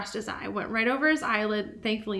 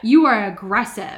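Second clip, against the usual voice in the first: mock scolding; 9 dB louder than usual. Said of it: "then I would be like".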